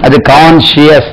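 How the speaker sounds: normal talking voice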